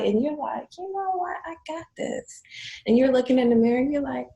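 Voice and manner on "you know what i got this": high, soft inner pep talk; self-assured. Retold or imitated. Client walking tall, telling herself, self-assured. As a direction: voice a newly confident client, pitched up and light.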